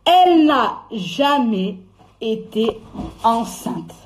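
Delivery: "Elle n'a jamais" drawn out slowly, then "été" and "enceinte" set apart; slow word-by-word "Elle n'a jamais été enceinte"; emphatic revelation. Real, not acted. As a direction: hammer it out with pauses before "été" and "enceinte".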